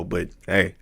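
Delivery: ordinary talk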